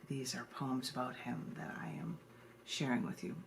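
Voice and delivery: ordinary delivery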